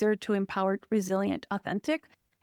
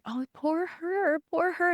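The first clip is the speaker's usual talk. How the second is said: higher, slow, sing-song mock sympathy; mocking pity; 7 semitones higher, slower than usual. Mocking how others pitied her mother, sarcastic and slow.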